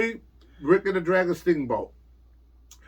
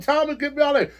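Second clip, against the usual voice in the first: panicked plea; 9 semitones higher, faster than usual. Retold or imitated. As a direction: beg to be driven away, half laughing at yourself. Panicked retold plea in car.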